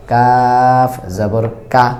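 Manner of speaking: slow কাফ জবর কা with a bright open a; drawn-out কা, light and open; light কাফ recitation. Shown as a demonstration. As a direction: contrast letter: কা like Bengali আকার. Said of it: "পরের হরফটা আছে কাফ".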